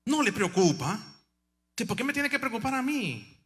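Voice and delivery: a hint of the bold dying sister; sister challenging. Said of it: "Entonces vino algo".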